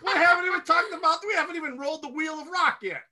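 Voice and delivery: high-pitched, laughing objection; incredulous protest. Real, not acted. Co-host stopping an early sign-off, incredulous high protest. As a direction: protest in amused disbelief.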